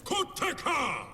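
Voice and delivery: a drawn-out war roar; battle cry. Acted. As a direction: roar it as you charge. War cry before attack.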